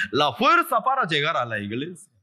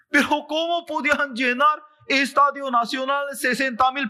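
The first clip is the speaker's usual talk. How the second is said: high-pitched, incredulous preaching; excited preaching; 13 semitones higher, 4 dB louder than usual. Real, not acted. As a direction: pile up amazed questions at a high pitch. Preaches amazed about the full stadium.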